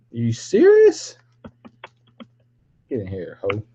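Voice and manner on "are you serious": very high squeal on serious; squeaky disbelief. Real, not acted. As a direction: jump way up in mock outrage.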